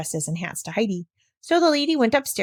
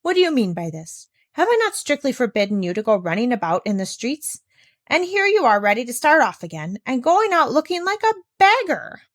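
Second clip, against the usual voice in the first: scolding that climbs louder and higher to the end; rising scolding; 5 semitones higher, 5 dB louder than usual. Acted. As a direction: tear into the child, building. Governess scolds runaway child.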